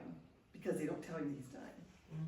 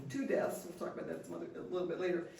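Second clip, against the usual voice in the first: usual speaking voice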